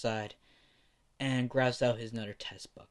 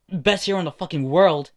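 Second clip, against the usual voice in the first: loud boast; 4 semitones higher, 9 dB louder than usual. Acted. Bakugo boasting to class.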